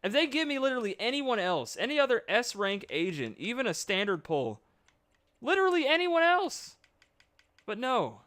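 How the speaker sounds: high, loud frustrated rant; exasperated rant. Real, not acted. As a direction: rant at the game. Ranting plea.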